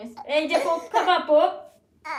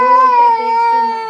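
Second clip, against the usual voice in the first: loud, slow, higher warning; stern warning; 8 semitones higher, 13 dB louder, slower than usual. Real, not acted. Mother warning the crying toddler, loud slow caution.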